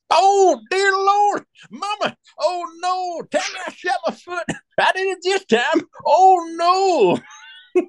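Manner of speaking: very high, crying Cajun wail; mock-panicked high wail, half laughing at the end. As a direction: howl to Mama like the foot is gone. Danny wailing after shooting his foot.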